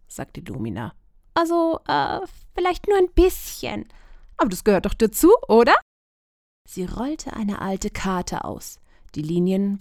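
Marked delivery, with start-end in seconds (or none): 1.3-5.7 higher, admitting a little fear; sheepish girl. A narrator's reading. Lumina admitting she is a bit scared, higher. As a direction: own up with a nervous laugh.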